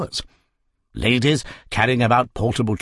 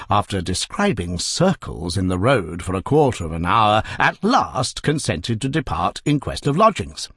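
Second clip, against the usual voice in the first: ordinary delivery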